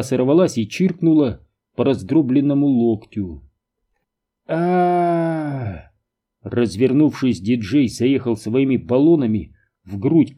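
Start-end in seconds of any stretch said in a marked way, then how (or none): 4.5-5.9 long, high "aah" of pain; acted wail, held and then falling away; cry of pain. A narrator's reading. Cry as injured elbow is struck. (протяжный крик боли)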